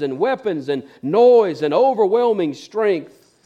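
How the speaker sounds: high, loud, fervent preaching; full-force preaching. Acted. Pastor preaching the Bible to his church, high and loud. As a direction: drive it home to the congregation.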